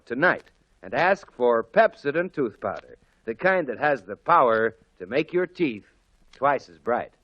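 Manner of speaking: normal talking voice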